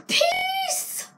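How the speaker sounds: Peace squealed very high. Real, not acted. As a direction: yelp it in a high shriek. Squeals at person in light.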